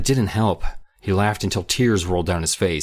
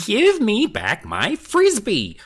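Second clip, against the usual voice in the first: insisting whine; 4 dB louder, much slower than usual. A narrator's reading. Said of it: "Kermit insisted".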